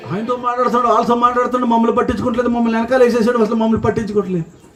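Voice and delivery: high-pitched sulky whining; petty, high whine of being left behind; whiny congregant. Pretend. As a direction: sulk that the pastor ignores you. Mimics congregant feeling ignored.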